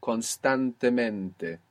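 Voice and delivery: constantemente said slowly as one word, kon-stan-te-MEN-te; slow Spanish model. Shown as a demonstration. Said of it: "And it's one word".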